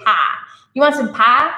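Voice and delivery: lightly voiced Texan pie, a drawled single vowel; Texas accent. Imitating her late Texan stepmom offering pie. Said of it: "she would always say".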